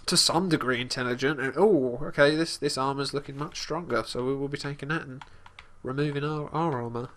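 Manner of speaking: ordinary talk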